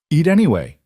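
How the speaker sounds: firm. A narrator's reading. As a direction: insist on it, brooking no refusal. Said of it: "He said it firmly enough".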